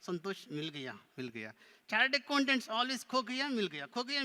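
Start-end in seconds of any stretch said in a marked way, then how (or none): 1.9-4.2 higher-pitched puppet voice; teasing puppet. Pretend. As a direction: tease the crowd as the puppet. Puppet mocks the audience.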